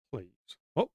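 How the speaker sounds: ordinary talk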